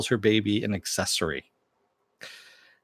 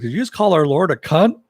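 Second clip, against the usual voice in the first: indignant; 7 semitones higher, 6 dB louder than usual. Retold or imitated. Vendor outraged by Billy's blasphemy.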